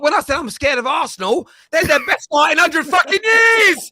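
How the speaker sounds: high, loud protest building to a full shout; worked-up yelling, pitch climbing; heated shouting. Real, not acted. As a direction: shout your defence over the teasing. Shouting back in heated banter.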